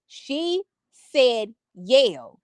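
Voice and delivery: "yell" stretched long and slow; stressing yell. Shown as a demonstration. Mother insisting to viewers on the word, very slow stress on yell. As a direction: drag out "She said yell" very slowly. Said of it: "Now, yells, y'all".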